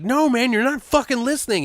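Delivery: high, pressing exasperation; exasperated plea. Acted. The driver pleading with his stubborn coworker, high and exasperated. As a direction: beg him to finally hear you.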